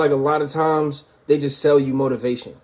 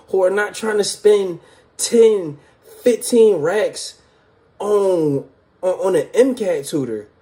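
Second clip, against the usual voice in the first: frustrated, exasperated; 6 semitones higher than usual. Real, not acted. Complaining about tutor prices.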